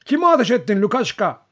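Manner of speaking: high, loud shouted question; urgent demand. A narrator's reading. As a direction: press for an answer, shouting. Urgent repeated question after gunshot.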